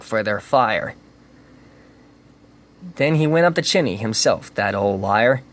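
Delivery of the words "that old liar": plain reading voice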